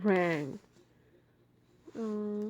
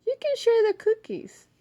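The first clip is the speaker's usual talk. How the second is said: Ma's voice; 13 semitones higher, 8 dB louder than usual. Acted. Ma voiced in story.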